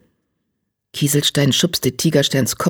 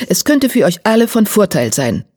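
higher-pitched firm appeal; 6 semitones higher than usual. A narrator's reading. Grey warrior persuades the guard to let them by, firm. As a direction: argue it with conviction. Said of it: "sagte er bestimmt".

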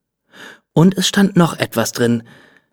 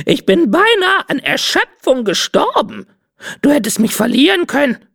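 high parrot voice, overplayed self-pity; a diva parrot fishing for sympathy; theatrical parrot; 12 semitones higher than usual. Parrot Cinzia dramatizing her exhaustion, high theatrical parrot voice.

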